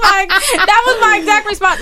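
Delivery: high, loud, talking through laughs; laughing. Real, not acted. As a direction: say it while still laughing.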